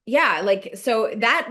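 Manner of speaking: normal talking voice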